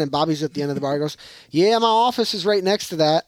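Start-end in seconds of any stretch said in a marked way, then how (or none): 1.5-3.2 put-on country accent. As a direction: imitate a small-town local with a Southern country accent. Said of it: "sounds like he's from Alabama".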